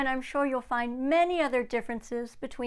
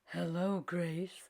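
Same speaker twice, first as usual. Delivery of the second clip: gentle greeting; 5 semitones lower, 7 dB quieter, slower than usual. Acted. Lincoln greets the girl.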